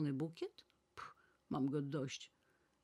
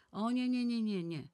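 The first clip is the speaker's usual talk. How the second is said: loud, much higher string of no's; emphatic refusal; 7 semitones higher, 7 dB louder than usual. A narrator's reading. Nana refusing to hand her aunt all the money, loud emphatic protest. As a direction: pull the money back, protesting.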